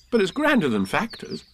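put-on high child's voice, hastily consoling; quick reassurance. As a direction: add it eagerly as a little boy. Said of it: "he added quickly".

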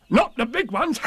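very high, boastful tiger voice; Tigger. A narrator's reading. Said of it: "said Tigger".

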